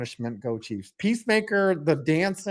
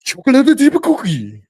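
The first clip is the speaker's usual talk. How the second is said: loud, high mock-Japanese delivery; hammed-up fake Japanese accent; 8 semitones higher, 10 dB louder than usual. Shown as a demonstration. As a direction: shout it as faked Japanese. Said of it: "I can say one word in Japanese".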